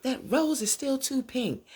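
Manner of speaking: high, loud mock yell; yelling mother. Mother yelling over the rose, high mock yell. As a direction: burst out high on the first word, then ease the volume. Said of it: "she just starts yelling".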